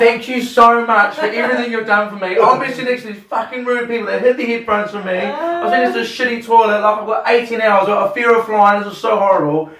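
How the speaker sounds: high, loud, gushing and overwrought; over-emotional retold rant; emotional outpouring. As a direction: pour it all out at a high pitch, overcome with emotion. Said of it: "I'm so emotional".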